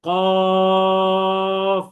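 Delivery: loud drawn-out কফ for the class to repeat; সবাইকে শেখাতে টেনে কফ; model qaf. Shown as a demonstration. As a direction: stretch কফ long and heavy from the tongue root. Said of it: "আমার সাথে সাথে সবাই একবার বলেন".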